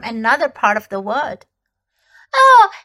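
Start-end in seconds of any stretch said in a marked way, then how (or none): 2.3-2.7 put-on much higher, loud excited girl; Lucy calling to her brother, thrilled; delighted cry. A narrator's reading. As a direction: cry out with joy at finding him. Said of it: "she cried".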